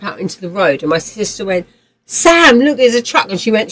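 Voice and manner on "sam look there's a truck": high, urgent call to a friend; retold warning call. Sister calls to Sam about the truck, retold warning call. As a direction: call out a quick mock warning.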